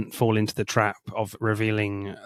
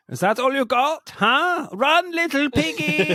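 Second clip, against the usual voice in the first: high, taunting villain voice; mock hitman taunt. Quoting the hitman taunting Eric during the chase, high jeer. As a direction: jeer at him like a gleeful hitman. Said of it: "And he says at one point".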